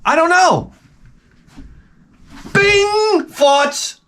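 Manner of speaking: high mock tic outbursts, pretending to have them. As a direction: squeal out fake tics. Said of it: "I want Tourette's".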